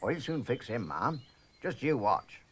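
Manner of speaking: ordinary delivery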